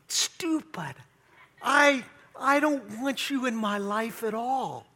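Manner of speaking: sweet, high, sing-song voice to the dog; loving tone. Shown as a demonstration. Owner cooing insults at his dog in a loving tone. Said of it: "And if I say it in a loving tone".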